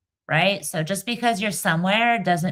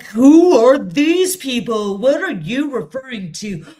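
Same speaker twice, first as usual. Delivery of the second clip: mocking whiny voice; 7 semitones higher, 5 dB louder than usual. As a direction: parody an indignant know-it-all. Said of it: "they're gonna be like".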